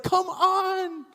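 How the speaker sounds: high, pleading come on; desperate plea. Retold or imitated. Jairus urging Jesus again.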